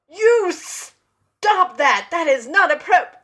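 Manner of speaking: high, loud, slow scolding yell; indignant shout. Retold or imitated. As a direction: scold the groper sharply. Yelling at bum-grabber.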